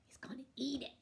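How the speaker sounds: hushed whisper. Real, not acted. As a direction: whisper it like a secret. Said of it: "whispering to child".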